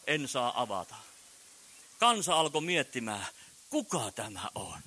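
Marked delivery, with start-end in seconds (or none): 3.7-4.8 a secretive whisper; hushed whisper. Acted. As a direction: whisper it as the wondering crowd. Crowd wondering aloud.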